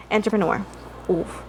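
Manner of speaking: normal talking voice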